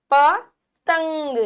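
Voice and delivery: पतंग slowed with a light न sound; nasal demo of पतंग; soft nasal n. Shown as a demonstration. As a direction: let a soft न hum through पतंग slowly. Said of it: "न की ध्वनि आएगी हल्की सी".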